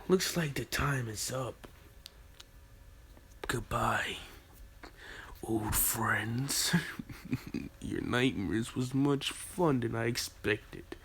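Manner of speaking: low, soft whispered goodbye with a breathy laugh; whispered farewell. Acted. Naruto whispering a sinister goodbye to his victims. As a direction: say goodbye like a sweet threat.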